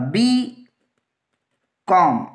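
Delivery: बी, long pause, कॉम; slow dictation of बी ... कॉम; dictated in parts. Shown as a demonstration. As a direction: split बी कॉम into two separate pieces. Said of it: "अब बी कॉम में हम क्या लिखेंगे".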